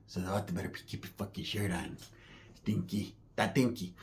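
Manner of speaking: a quick, breathy whispered aside. Acted. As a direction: lean in and whisper it fast, like a secret. Hushed aside to the audience.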